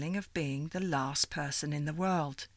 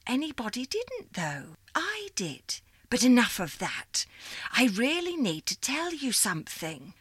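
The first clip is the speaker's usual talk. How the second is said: put-on old operator lady; 6 semitones higher than usual. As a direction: greet her like a fond old supervisor. Miss Liza continues.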